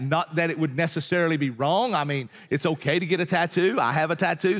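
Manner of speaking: ordinary talk, nothing marked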